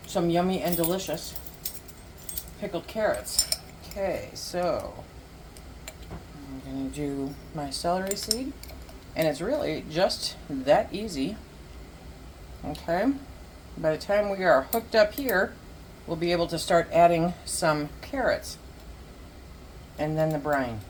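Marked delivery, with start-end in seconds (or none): none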